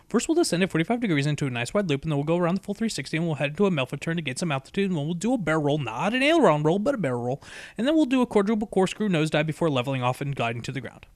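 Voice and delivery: fast, eager run-through of tricks; rapid rattle. Acted. Rainbow Dash rattling off tricks to Twilight, fast and eager. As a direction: rattle it all off in one breath.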